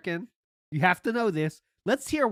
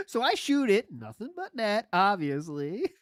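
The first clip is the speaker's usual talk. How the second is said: mock smug storyteller; 3 semitones higher than usual. Host predicting the storyteller's brag, mock-casual smugness. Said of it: "That's what Phil's gonna say".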